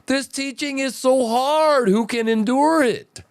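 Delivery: high, mock-whining complaint; mocking whiny disciples. Preacher mocking the crowd leaving Jesus, high whiny voice. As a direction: ham up the crowd's high whine. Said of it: "But everyone said".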